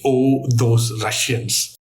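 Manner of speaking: slow, drawn-out song catchphrase. Retold or imitated. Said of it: "Thank you, Boney M".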